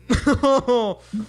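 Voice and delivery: high, loud laugh; cracking up. Real, not acted. Laughing at own botched accent, cracking up. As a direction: burst out laughing at yourself.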